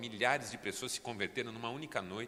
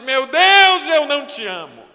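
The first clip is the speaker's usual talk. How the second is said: cried out much louder and higher; weeping confession; 25 dB louder than usual. A narrator's reading. Pastor on his knees confessing to God, crying out loud. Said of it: "começou a chorar e disse".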